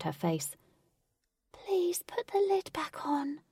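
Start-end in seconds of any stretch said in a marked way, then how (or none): 1.5-3.4 high, slow, whispered and timid; tiny nervous fairy voice. A narrator's reading. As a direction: shrink back like a frightened little fairy. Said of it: "said the fairy nervously".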